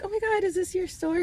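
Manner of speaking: high-pitched, friendly amazement; gushing retold question. Customer asking the man if it's his store, high and gushing. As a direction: re-enact gushing to a shop owner. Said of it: "And I was like".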